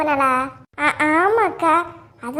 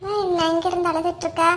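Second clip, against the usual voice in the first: usual speaking voice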